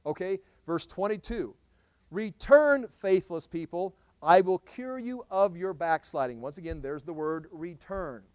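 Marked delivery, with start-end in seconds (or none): 2.1-6.4 God's plea read out strongly; impassioned scripture reading; pleading scripture reading. Acted. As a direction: call them home with force. Preacher voicing God's plea.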